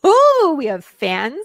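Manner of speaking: gleeful delight. Acted. Host learning they have fans, gleeful. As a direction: gush slowly over the news.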